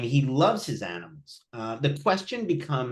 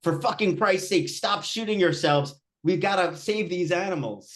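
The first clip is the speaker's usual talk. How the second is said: higher-pitched, exasperated cursing over the radio; mock exasperated rant; 7 semitones higher than usual. Vitaly on the walkie-talkie scolding the filming boys, exasperated higher yell. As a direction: vent at the boys like a fed-up rescuer. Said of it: "over the walkie-talkie saying".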